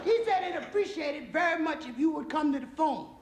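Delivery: very high falsetto wife; comic housewife voice, way up high; wife relaying message. Acted. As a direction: pipe the message down the phone in falsetto. Wife relays caller's request.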